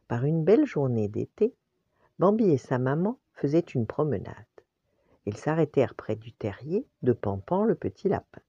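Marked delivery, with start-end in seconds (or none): none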